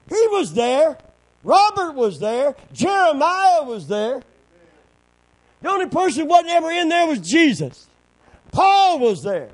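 loud, high, rousing sermon delivery; full-force preaching. Real, not acted. Preacher stirring the congregation with fighting faith, full-force shouting. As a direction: preach it hard, punching each phrase loud.

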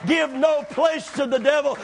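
fervent pulpit shouting; full-force preaching. Acted.

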